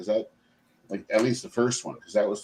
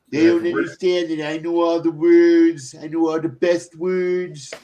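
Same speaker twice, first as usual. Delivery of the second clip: Trump impression; 6 semitones higher, 4 dB louder than usual. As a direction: lightly mimic a bragging politician. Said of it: "Is that your impression of Trump?".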